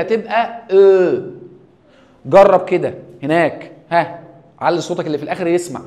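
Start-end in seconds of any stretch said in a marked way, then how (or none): 0.0-1.2 "او" slow and round, louder. Shown as a demonstration. Teacher stops to stress the "او" sound. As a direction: draw out a round "او". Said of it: "هنا مشكله".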